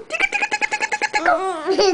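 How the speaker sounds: high squeaky playful tickle chant; giggly tickle attack. Real, not acted. Mother tickling baby, playful.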